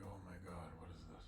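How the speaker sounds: soft, hushed whisper; awed whisper. Acted. Bodhi gasps at the huge arena, whispering. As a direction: breathe it in wonder.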